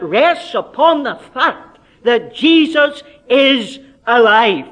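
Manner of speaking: loud, earnest pulpit appeal; fervent preaching appeal. Acted. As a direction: press the question on the congregation. Evangelistic appeal to hearers.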